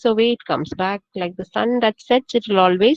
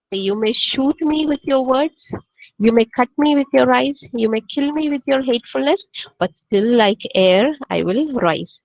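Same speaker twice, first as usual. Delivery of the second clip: poem recitation; 2 semitones higher than usual. Shown as a demonstration. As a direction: recite each line deliberately, letting it land. Teacher recites poem stanza.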